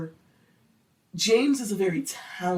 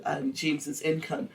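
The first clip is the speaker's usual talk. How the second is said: ordinary talk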